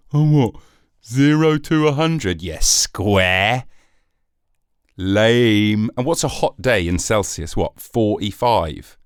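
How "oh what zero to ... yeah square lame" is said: mock American scoffing. Mocking American view of Celsius.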